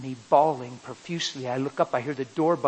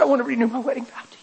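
rushed, much faster repeat of the vow; 10 semitones higher, 5 dB louder, much faster than usual. Retold or imitated. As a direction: blurt it out quickly again. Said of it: "And she said, "Huh?".

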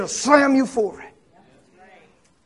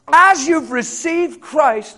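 slow reading; 5 semitones higher, 5 dB louder, much slower than usual. Shown as a demonstration. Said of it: "It says".

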